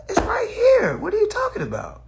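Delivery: high, loud incredulous "It's right here"; a squeaky, stunned exclamation; high mock disbelief. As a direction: sound floored by how easy it was. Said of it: "I'm like".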